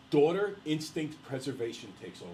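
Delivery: usual speaking voice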